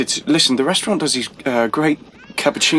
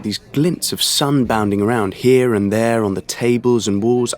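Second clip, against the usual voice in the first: normal talking voice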